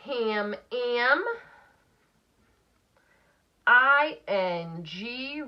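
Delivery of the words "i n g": each letter said slowly and separately; slow letter spelling. Shown as a demonstration. Teacher spelling I N G slowly for students. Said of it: "spelling letters for decoding".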